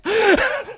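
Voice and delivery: high burst of laughter; real laughter. Real, not acted. Host losing it after the voice, laughing. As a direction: lose it laughing. Host cracks up at own impression.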